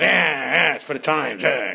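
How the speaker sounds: mimicking an irritated star; grumbled mock echo with grunts; irritated grumble. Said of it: "'cause he's irritated".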